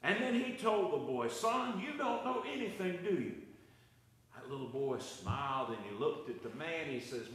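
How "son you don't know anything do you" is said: exasperated high-pitched retort; a touch of fed-up impatience; mock exasperation at the boy; frustrated man. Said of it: "the man was frustrated at that point".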